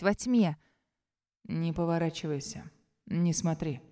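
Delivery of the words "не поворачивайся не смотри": низкий тихий приказ шёпотом; прошептать прямо в голову. Acted. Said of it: "Раздаётся шёпот незнакомца". (властным шёпотом)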